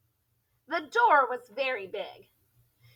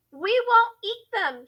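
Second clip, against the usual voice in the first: a high, raised class chant; children shouting; 8 semitones higher, 4 dB louder than usual. Acted. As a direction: shout it back together, pitched up. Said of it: "shouted the children".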